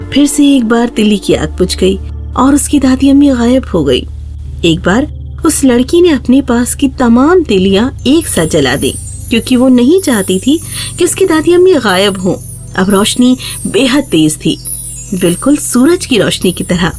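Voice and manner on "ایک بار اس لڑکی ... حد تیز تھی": usual speaking voice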